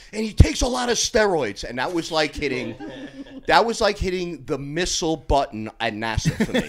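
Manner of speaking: ordinary talk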